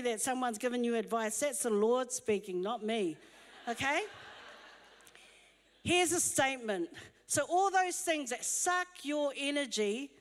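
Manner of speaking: ordinary delivery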